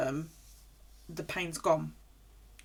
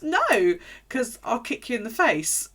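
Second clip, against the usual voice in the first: firm refusal; 5 semitones higher, 6 dB louder than usual. Retold or imitated. Refusing partner's offer.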